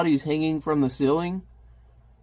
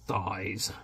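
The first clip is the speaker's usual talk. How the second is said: hushed, swooning whisper; mock ecstasy; 7 semitones lower, 15 dB quieter, much slower than usual. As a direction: sigh it out in hammed-up bliss. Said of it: "he's in ecstasy".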